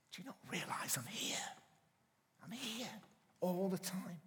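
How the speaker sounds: soft whisper; little voice. Pretend. God's little voice behind us, gentle whisper. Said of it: "this little voice behind us says".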